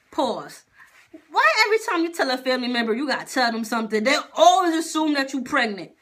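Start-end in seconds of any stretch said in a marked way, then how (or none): 1.3-5.9 high, fast exasperated rant. Real, not acted. Venting about relatives who assume pregnancy, exasperated. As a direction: burst out in loud, rapid complaint.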